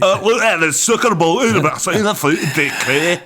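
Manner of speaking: high, mumbled mock Scottish accent; garbled Trainspotting-style Scottish accent; hammed-up thick Scottish brogue. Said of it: "that's more Scottish".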